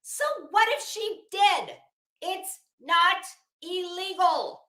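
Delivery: high, loud so what, pushing back hard; defiant retort. Retold or imitated. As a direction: fire back with scornful defiance. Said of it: "to which I said".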